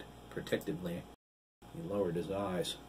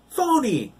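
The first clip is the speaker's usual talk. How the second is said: very high, loud jeer; shouted taunt; 12 dB louder than usual. A narrator's reading. Boy yells the insult while struggling, very high. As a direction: yell it while pulling free. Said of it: "Sean managed".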